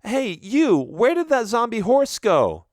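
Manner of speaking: high, loud call across the street. Acted. Daphne calling out to people in the wrecked village, loud and high. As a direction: shout to get the villagers' attention.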